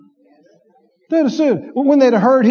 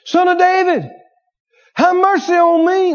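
crying out; 8 semitones higher than usual. A narrator's reading. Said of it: "and cried out".

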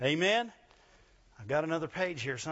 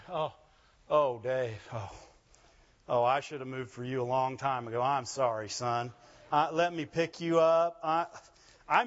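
low, sorrowful oh-dear voice; mock pitying God; 4 semitones lower than usual. Preacher as God looking down on a sufferer, mock pitying. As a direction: play God apologizing with exaggerated sympathy. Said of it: "He can look down and say".

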